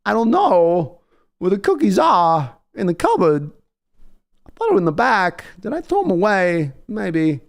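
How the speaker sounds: Walken impression. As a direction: muse about lost cookies in a hammed-up Walken voice. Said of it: "if I start doing it too long, suddenly just find myself".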